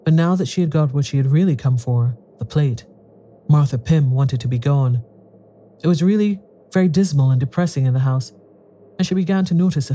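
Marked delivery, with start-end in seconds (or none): none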